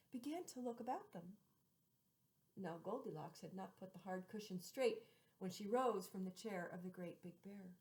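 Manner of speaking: usual speaking voice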